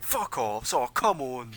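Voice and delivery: gruff jeering tavern patron; grumbling crowd heckler. Acted.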